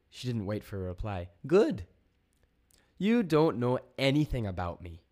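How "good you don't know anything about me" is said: loud, high, indignant challenge; emphatic, raised-voice rebuke; defiant. A narrator's reading. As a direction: tell them off with defiance. Joni confronts the alien voice.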